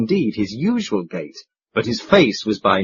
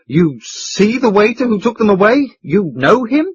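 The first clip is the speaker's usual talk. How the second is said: higher and louder, urgently pressing; excited hotel keeper; 8 semitones higher, 4 dB louder than usual. A narrator's reading. Hotel keeper pressing the chairman, excited. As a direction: push the question, agitated. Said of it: "panted the excited hotel keeper".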